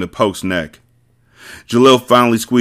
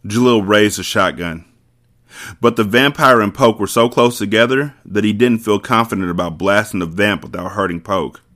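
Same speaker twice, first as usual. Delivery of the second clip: usual speaking voice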